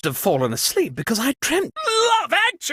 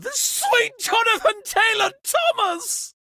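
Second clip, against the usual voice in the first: high, shocked shout of a made-up oath; startled cry; 14 semitones higher than usual. Acted. Host startled by the blackout, high shocked shout. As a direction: yelp the oath in sudden alarm.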